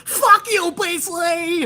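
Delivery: put-on very high, childish whine; troll yelling at the streamer from his keyboard, high whine; whiny troll. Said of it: "when these people are trying to talk shit".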